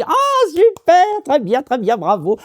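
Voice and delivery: squealing high with glee; delighted witch. Acted. Witch delighted the woodcutter agreed to marry her, high gleeful squeal. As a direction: gloat like a thrilled old witch. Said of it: "la sorcière est ravie".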